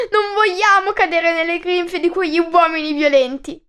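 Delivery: voce di fanciulle più alta, implorante; supplichevole. A narrator's reading. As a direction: implora aiuto con apprensione. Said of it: "supplicarono le figlie di Danao". (le figlie di Danao supplicano il re, voce alta e implorante)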